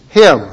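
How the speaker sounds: "him" set apart, loud; stress on him. Shown as a demonstration. Preacher points congregation to one word, loud isolated "him". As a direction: land hard on the single word "him". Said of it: "I want you to notice the word".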